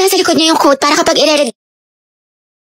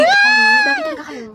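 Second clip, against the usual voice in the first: crying shout; 3 dB quieter than usual. Acted. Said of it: "Sobrang hina ng boses".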